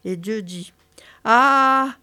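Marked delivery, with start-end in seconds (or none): 1.2-2.0 louder, drawn-out "Ah" for the doctor; sustained, raised "Ah"; long "Ah". Acted. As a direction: hold an open "Ah" as at a throat examination. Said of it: "Je vous prie de faire Ah".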